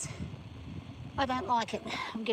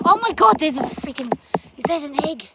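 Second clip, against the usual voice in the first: sudden loud, much higher burst of surprise; startled exclamation; 7 semitones higher, 11 dB louder than usual. Real, not acted. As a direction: jolt of real surprise at a find. Spots an egg-like rock suddenly.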